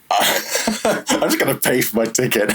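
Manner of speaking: high, laughing through the words; laughing retold quote. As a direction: act out giving up, amused.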